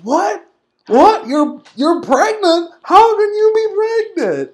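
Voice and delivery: high, incredulous mock squeal; Mike Kanellis. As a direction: rise into exaggerated disbelief. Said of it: "Mike Kanellis from me goes".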